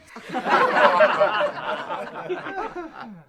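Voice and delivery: loud laughing fit; big laugh. Acted. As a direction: crack up loudly at your own joke. Puppet laughs at own joke.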